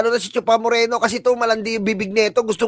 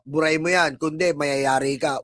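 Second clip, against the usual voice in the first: mock threat; 7 semitones lower, 5 dB quieter than usual. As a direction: drag out the threat in a low voice to mock it. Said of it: "Tinatakot ako".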